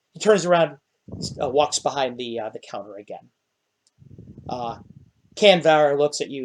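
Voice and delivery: normal talking voice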